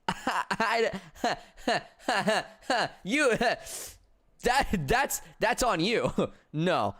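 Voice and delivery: high-pitched, broken up by laughter; helpless giggling between words; laughing. Real, not acted. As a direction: try to talk through real laughter. Laughing while teasing the viewers.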